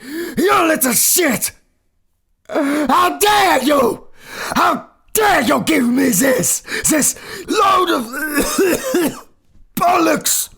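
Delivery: furious shout. Acted. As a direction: bellow every insult at him. Composer berates him over the pages.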